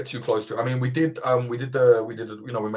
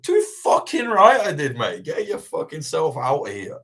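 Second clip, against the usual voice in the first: retold defiant shout; 7 semitones higher, 3 dB louder than usual. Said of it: "I was like".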